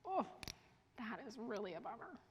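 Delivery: quiet, consoling parent voice; gentle empathetic murmur; soft sympathy. Shown as a demonstration. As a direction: soothe a child over a broken toy. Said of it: "position yourself alongside them".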